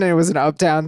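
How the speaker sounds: usual speaking voice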